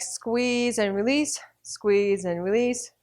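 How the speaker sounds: slow, steady instructor call; slow rhythmic cue; slow exercise cue. Shown as a demonstration. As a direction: draw the words out to pace the squeeze. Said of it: "cueing the left-side exercise".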